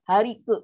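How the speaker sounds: Hari ke with the q held back then a ke. Shown as a demonstration. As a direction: trap the q in Hari ke. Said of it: "dia nak keluar tapi kita tahan".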